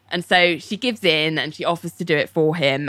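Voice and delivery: ordinary talk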